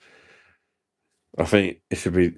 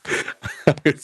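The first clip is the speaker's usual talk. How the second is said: breathy laugh; 8 semitones higher than usual. Real, not acted. Laughs while singing.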